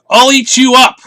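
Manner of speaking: high, loud wild shout; Max shouting. Acted. Boy in wolf suit yelling at his mother, loud and high. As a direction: yell it like a wild child. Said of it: "and Max said".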